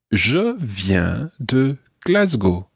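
slow, word-by-word "Je viens de Glasgow"; slow model phrase. Shown as a demonstration. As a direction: say "Je viens de Glasgow" slowly, spacing the words for a learner. Teacher models phrase slowly for learner.

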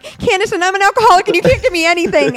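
high, loud, fast blurted confession; words bursting out before she can stop them. Retold or imitated. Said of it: "I had to speak when I didn't want to".

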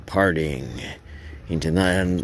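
plain reading voice

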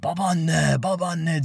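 mild mock yell of Babaanne; retold shout from the lungs. Calling grandma still inside the shaking building, mild mock yell. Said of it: "diye böyle ciğerden bağırıyorum".

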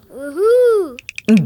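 high, hooting owl call. Acted. The little bird answers with a high owl hoot.

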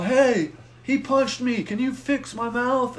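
high, drawn-out call for help; frantic plea. Retold or imitated. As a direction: act out your panicked self calling to paramedics. Pleading with paramedics while tripping.

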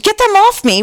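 high-pitched mock scream at modest volume; retold scream. Sleeper biting his own arms, mildly mock screaming. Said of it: "he repeatedly screamed".

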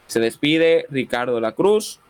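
usual speaking voice

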